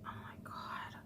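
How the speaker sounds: breathless frustrated whisper. Real, not acted. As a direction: mutter it under your breath. Frustrated aside while gluing lashes.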